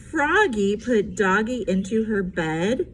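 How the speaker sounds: ordinary delivery, nothing marked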